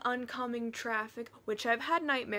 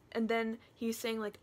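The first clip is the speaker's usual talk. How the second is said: ordinary delivery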